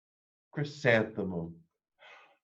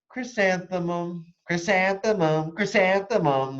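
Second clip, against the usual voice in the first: high, slow, sing-song calling; dreamy flower voice; 8 semitones higher, 4 dB louder than usual. A narrator's reading. A flower calling to Chrysanthemum on the way to school, dreamy and high. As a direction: croon the name like a flower calling. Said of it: "the flower seemed to say".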